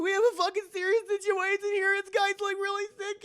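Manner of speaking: high, loud mock-panicked woman; hammed-up panic in a high voice; panicked Dirt Girl. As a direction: squeal out an urgent emergency report. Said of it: "the, the Dirt Girl saying".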